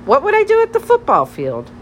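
high-pitched baffled question; bewildered squeak of a question; incredulous. A narrator's reading. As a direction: shoot up high in disbelief. Baffled reply to silly suggestion.

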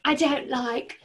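mock British lady voice; British accent. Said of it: "I'm gonna make her British".

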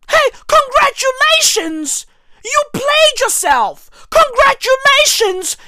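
high sarcastic cheering voice; sarcastic congratulations. Acted. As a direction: hand out fake praise at full pitch.

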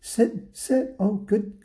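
high, sweet dog-training voice; nice, encouraging. Acted. Lady now kindly training her dog, high sweet coaxing. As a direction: coax and praise a pet in high pitch. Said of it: "the lady was so nice".

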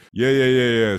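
loud, high yeah yeah; excited cheer. Real, not acted. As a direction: cheer it out loudly. Cheering hype.

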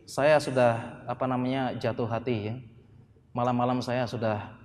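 low, soft mock-romantic murmur; hammed-up soft wooing, low and slowish; tender love confession. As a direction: play a bashful suitor confessing, hushed and halting. Acting out a suitor's love confession.

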